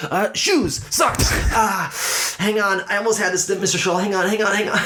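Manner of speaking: put-on frantic kid. Kid frantically guessing, asks to wait.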